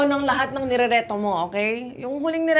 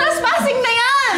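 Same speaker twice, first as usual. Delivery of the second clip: high, loud playful protest; mock outrage; 10 semitones higher, 6 dB louder than usual. Real, not acted. Jen scolds her sister for barging in, playful outrage. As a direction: call her out, half joking.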